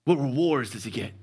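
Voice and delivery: hushed whisper, low and secretive; David whispering to the soldiers. Retold or imitated. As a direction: lean in and whisper the question. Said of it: "I kinda imagine it like this".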